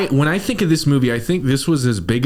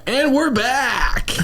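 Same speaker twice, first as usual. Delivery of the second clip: high announcer voice; 12 semitones higher, much slower than usual. Pretend. Echoes show return high slow.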